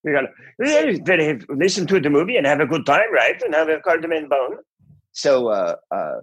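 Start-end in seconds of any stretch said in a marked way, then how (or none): 0.0-4.6 high, singsong mock-Scandinavian accent; babbly Swedish Chef-style accent; cod Scandinavian accent. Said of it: "that's how Americans hear it".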